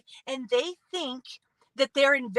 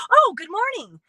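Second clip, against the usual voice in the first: a bright, higher, louder little "oh" of surprise; pleasantly caught off guard; 7 semitones higher, 6 dB louder than usual. Retold or imitated. Someone glancing up from their phone and returning a greeting, pleasantly surprised. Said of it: "scrolling Facebook and somebody says good morning".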